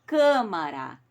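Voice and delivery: câmara said with the stress on the first syllable, cã. Shown as a demonstration. Teacher modelling câmara for pupils, stress on cã. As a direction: stress the opening cã of câmara, closed A. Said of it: "quando a gente fala".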